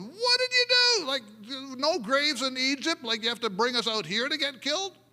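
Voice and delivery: very high, loud frantic complaint; hammed-up frantic Israelites; panicked crying out. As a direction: cry out to Moses in mock panic. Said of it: "They start crying out to Moses".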